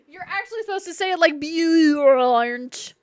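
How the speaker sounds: over-the-top New Zealand impersonation, stretching the last word; goofy New Zealand accent. Retold or imitated. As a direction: correct them in a hammed-up Kiwi accent. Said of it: "speaks in a very goofy way".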